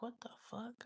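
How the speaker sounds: imitating a mom's whispered shock; hushed disbelief. Mom reacting to news of the money, whispered disbelief. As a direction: whisper it, stunned.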